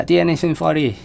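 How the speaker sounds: high-pitched attempt at a Scottish accent. Streamer playfully mimicking for viewers, lightly put-on Scottish accent. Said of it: "I apologise to any Scottish people watching for that".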